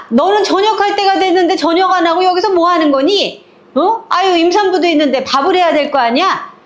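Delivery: high, nagging mother-in-law scold. Retold or imitated. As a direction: nag her like a bossy mother-in-law. 시어머니가 며느리를 나무람. (높은 목소리로 잔소리하는 시어머니)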